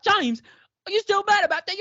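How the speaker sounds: high, loud put-on British accent; mock British accent. Imitating the actor's slipping British accent, high and loud. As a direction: slip into a hammed-up British voice.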